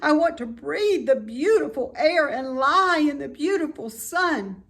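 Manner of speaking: high, loud tearful lament; tearful pleading. A narrator's reading. Wilbur weeps over his life to his friends, high and loud. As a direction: plead through tears.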